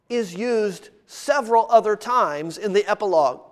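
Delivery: ordinary talk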